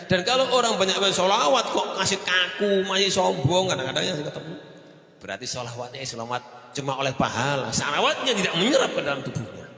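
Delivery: loud, high, fervent preaching; preaching at full force. Acted. Preacher warning the congregation against arrogance, loud and fervent. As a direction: drive the warning home to the crowd.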